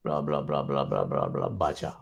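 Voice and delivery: low, droning bla bla of someone just reading off the slide; mocking monotone reading. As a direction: mimic a dull reader rattling on. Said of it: "Dia bacalah itu".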